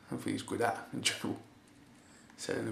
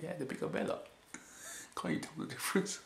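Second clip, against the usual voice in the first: higher, breathy teasing tone; imitating a giggling commentator; mocking snicker; 6 semitones higher, 5 dB quieter than usual. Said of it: "He's like".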